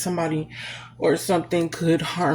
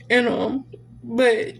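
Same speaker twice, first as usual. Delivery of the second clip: usual speaking voice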